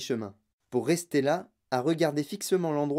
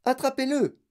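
high-pitched command; raised, commanding call; urgent order; 9 semitones higher than usual. Acted. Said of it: "ordonna monsieur Wonka".

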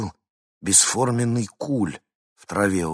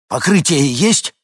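tense, higher, louder, slower; anxious; 9 semitones higher, 11 dB louder, slower than usual. A narrator's reading. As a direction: ask urgently, strained. Hinkin anxious question.

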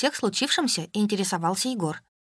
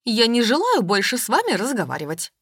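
louder, offended huff; the offended mother ends the talk: louder nervous huff; 3 semitones higher, 4 dB louder than usual. A narrator's reading. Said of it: "нервно сказала она".

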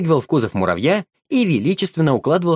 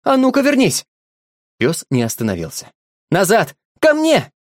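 shouting commands; 6 semitones higher than usual. A narrator's reading. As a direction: yell after the dog to come back. Boy yells for dog to return.

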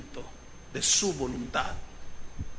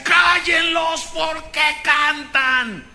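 high, slow, furious shouted order; Nero's furious shout; 8 semitones higher, 5 dB louder, much slower than usual. Retold or imitated. Preacher acting Nero shouting at his guards to silence singing Christians. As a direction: roar it like an enraged emperor. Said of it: "y gritaba y decía".

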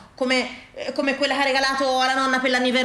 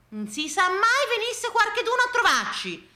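high-pitched mimicry of the woman next door; mock her excuse in a high voice; high, fretful housewife impression; fussy neighbour; 9 semitones higher than usual. Acts out neighbour's habitual excuse.

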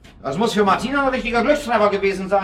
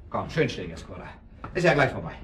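soothing the dog; 9 semitones lower, 8 dB quieter than usual. Acted. Calming the restless dog Cora.

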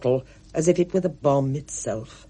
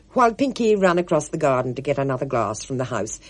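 ordinary delivery

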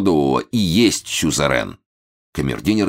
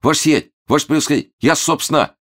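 high, rushed, stammering excuse; panicked babbling; 11 semitones higher, 3 dB louder, faster than usual. A narrator's reading. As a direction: babble fast in a squeaky fright. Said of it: "залепетал". (испуганно лепечет высоким голосом)

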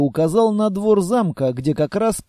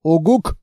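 owl-like hoot; 3 semitones lower than usual. Acted. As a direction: hoot like a big friendly monster. Monster answers the girl.

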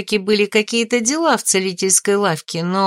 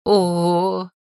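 a long breathy "oh" of delight; impressed gasp; 2 semitones lower than usual. A narrator's reading. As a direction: exhale in pleased surprise. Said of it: "выдохнула за спиной хозяйка".

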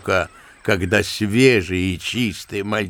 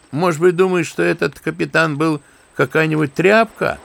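ordinary delivery

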